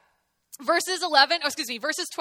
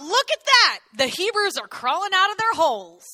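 taunting shout; 4 semitones higher, 5 dB louder than usual. Retold or imitated. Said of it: "The Philistines shouted out".

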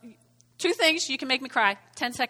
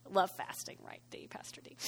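teasing whisper to a colleague; whispered aside; 4 semitones lower, 13 dB quieter, faster than usual. Real, not acted. Pastor teasingly whispers to Pastor D about fasting. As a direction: lean in and whisper the joke.

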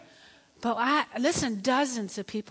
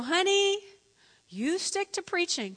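higher, measured wifely correction; slow down and set him straight; mock-sweet firmness; patient put-down; 6 semitones higher than usual. Wife putting husband down.

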